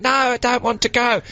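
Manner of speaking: high, pleading prisoner voice; high whiny prisoner. Retold or imitated. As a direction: whine it out like a reluctant convict. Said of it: "They'd be like".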